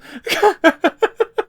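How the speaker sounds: squealing laugh; high burst of laughter. Real, not acted. Laughs after shouting.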